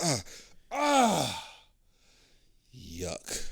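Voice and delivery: gag and recoil; groaning yuck demo; disgusted groan then yuck. Shown as a demonstration. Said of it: "Just, like, as soon as you just".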